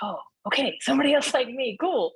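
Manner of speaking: light up with relief; delighted to find a kindred spirit; happy relief. Retold or imitated. Relief at finding David relatable.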